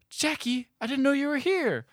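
high, astonished greeting; mock startled. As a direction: gasp it out high, as if caught off guard. Retelling startled man meeting his lady.

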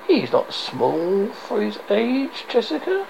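high, slow wheezy crone voice; wheezing old woman. A narrator's reading. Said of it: "Her vo-, her voice wheezed and twanged".

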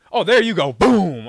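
loud gleeful found you; excited boom. Retold or imitated. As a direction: slam it out like landing the hit. Retold hit on safety.

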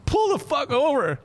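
high, drawn-out frantic holler; throwing a fit. Acted. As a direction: blow up at the driver, slowly stretched. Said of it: "he's having a fucking fit".

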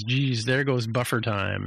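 usual speaking voice

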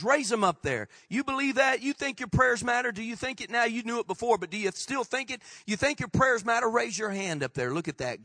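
ordinary talk

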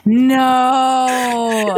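a high cry of disbelief; shocked no. Real, not acted. Hearing the child's answer, a shocked high no. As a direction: let a stunned no burst out.

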